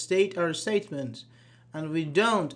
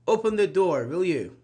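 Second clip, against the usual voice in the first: louder, firm order with the tag tacked on; firm command; 5 dB louder than usual. Shown as a demonstration. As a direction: bark "Open the door" like a command.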